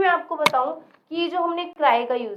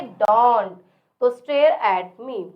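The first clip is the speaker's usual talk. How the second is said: stare at me dragged very slowly; slow modelling; 2 semitones lower, 4 dB louder, much slower than usual. Shown as a demonstration. Teacher slowly modelling stare at me for the class. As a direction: draw each word out for learners. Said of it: "teacher models English slowly".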